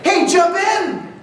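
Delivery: high, slow, friendly invitation. Retold or imitated. Best friend picking you up, high slow call. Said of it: "They say".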